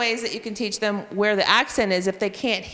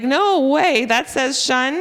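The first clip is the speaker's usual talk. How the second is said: louder and higher, thrilled disbelief; amazed kids; 6 semitones higher, 6 dB louder, slower than usual. Retold or imitated. Teacher imitating kids amazed by the shun sound. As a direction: play the kids who can't believe it. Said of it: "They're like".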